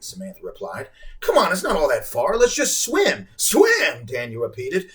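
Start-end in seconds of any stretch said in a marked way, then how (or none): 1.2-3.3 high, loud, impatient urging. Acted. Girl urges swim. 3.4-4.1 high, loud disbelief; as if it's foolish; incredulous. Acted. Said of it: "as if this was the most foolish thing".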